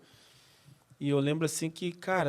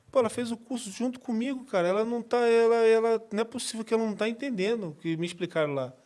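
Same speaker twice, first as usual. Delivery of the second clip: higher-pitched, puzzled insistence to the pastor; retold protest; 6 semitones higher than usual. Convert protesting to his pastor about his wife, insistent. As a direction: plead the case, pitched up.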